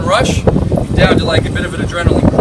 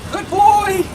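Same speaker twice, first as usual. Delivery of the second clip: much higher, bright sing-song praise; praising dog; much slower than usual. Real, not acted. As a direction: reward the dog with a lifted, happy voice.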